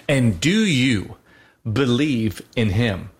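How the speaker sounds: drawn-out, solemn questioning as in the rite; a priest asks the catechumen, slow and solemn; slow liturgical question. Retold or imitated. A priest asks the catechumen at baptism, solemn and drawn out.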